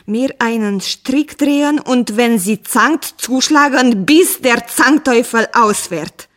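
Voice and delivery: loud, exasperated outburst; indignant. A narrator's reading. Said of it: "rief der Bauer unwillig".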